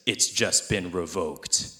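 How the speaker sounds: low, slow one-liner; a slow, low action-hero quip; lightly voiced tough cop, low and slow; deadpan cop line. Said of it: "and he says".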